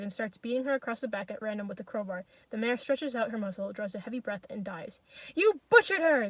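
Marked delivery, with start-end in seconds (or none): 5.4-6.3 high, loud outcry; raised indignant yell; accusing shout. A narrator's reading. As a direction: shout it at the killer from the crowd. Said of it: "they shout in the crowd".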